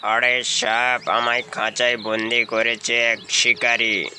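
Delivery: slow, slightly low caged tiger; a tired tiger pleading from his cage, slowly; lightly voiced tiger. Caged tiger telling the snake about the hunter, slow and a bit low.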